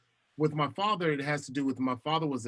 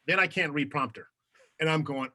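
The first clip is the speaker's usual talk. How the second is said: ordinary talk, nothing marked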